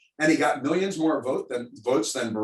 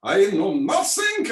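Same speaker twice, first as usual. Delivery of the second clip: put-on slow, higher German-accented "I know nothing"; mock German accent; 6 semitones higher, 4 dB louder, slower than usual. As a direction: do the bumbling German guard. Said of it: "we all grew up with the German accents".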